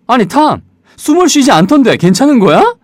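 high, fast, alarmed Jerry; Jerry's alarmed voice. Acted. Jerry shaking Tom awake, high and fast. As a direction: voice Jerry, panicked about Tom.

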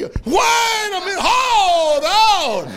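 high, loud, slow mock shout; hammed-up alarmed woman.

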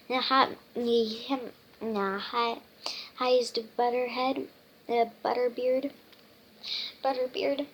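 ordinary delivery, nothing marked

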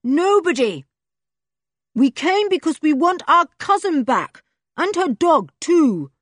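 high, loud booming shout. A narrator's reading. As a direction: boom back through cupped hands. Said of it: "boomed Julian".

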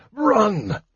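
out of breath. Acted. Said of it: "panted Fizban".

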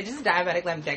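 ordinary talk, nothing marked

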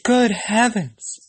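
high gasp of dismay; surprised. Acted. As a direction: exclaim in shocked dismay. Girl exclaims in dismay.

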